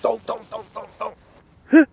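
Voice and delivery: loud, repeated cartoon sound-clip imitation; rapid-fire mock catchphrase, rising high; Homer Simpson. Imitating spammed sound clips on a game server, loud cartoon exclamations. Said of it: "There's a lot of Homer Simpson doll".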